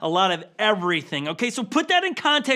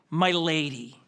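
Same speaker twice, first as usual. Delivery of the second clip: a tender, lower "My lady"; respectful; 6 semitones lower, slower than usual. Retold or imitated. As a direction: say it gently, with respect. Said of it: "more like".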